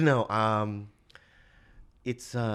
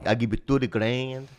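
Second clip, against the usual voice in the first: [name]. Retold or imitated. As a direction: make the offer in mock Korean-accented English. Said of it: "He go".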